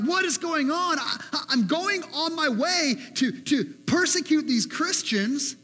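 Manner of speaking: alarmed, bewildered cry; startled Paul. Acted. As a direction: play Paul thrown off his horse. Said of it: "Paul's like".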